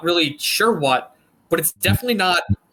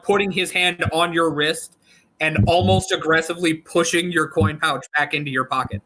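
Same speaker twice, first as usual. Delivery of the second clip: ordinary talk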